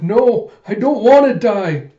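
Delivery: high, loud, slow wail; acted crying. Koyomi in tears before Den, high acted wail. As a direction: wail it in terrified protest. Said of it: "Koyomi's just crying with tears streaming".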